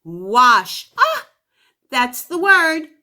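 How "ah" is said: ordinary talk, nothing marked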